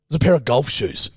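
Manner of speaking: normal talking voice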